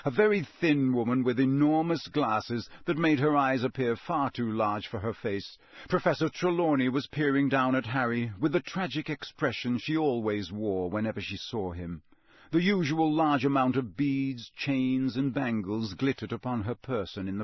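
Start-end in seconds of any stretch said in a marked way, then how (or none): none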